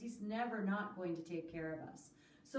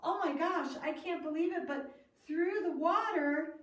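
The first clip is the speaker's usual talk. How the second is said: higher, louder nervous amazement; nervous and fearful; 6 semitones higher, 5 dB louder than usual. Acted. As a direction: gasp it out, scared but amazed. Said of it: "He was a little nervous and he was fearful".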